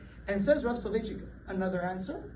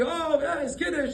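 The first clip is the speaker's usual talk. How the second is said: mock happy boast; 6 semitones higher, 3 dB louder than usual. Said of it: "he's all going happy".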